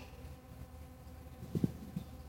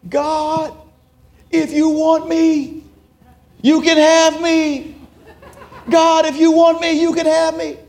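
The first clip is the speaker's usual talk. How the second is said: much higher, louder, slow pleading cry to God; sobbing prayer; 13 semitones higher, 6 dB louder than usual. Retold or imitated. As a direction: cry out to God in full surrender, high and loud. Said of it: "I'm sobbing".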